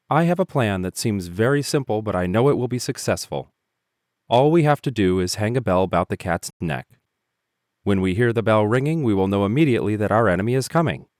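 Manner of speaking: put-on young mouse proud of his plan; eager young mouse. A narrator's reading. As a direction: pitch the plan with youthful zeal.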